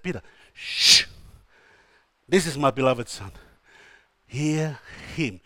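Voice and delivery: low, hushed voice of God; gently shush, then speak very softly; quiet, kindly divine correction; nice way. Retold or imitated. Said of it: "to Peter in a nice way".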